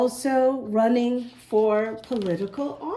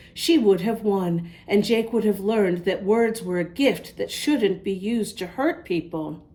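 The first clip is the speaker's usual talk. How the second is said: plain reading voice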